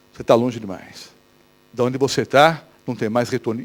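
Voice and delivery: a hint of the whispering tempter; devil voice. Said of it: "o diabo vem e diz o quê?". (voz baixa do diabo, desanimadora)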